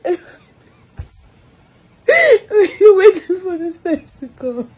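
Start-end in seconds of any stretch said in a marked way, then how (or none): 2.1-2.7 sharp high-pitched sob. Real, not acted. Mother mourning her baby, sobbing high. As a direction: let out one high, falling sob.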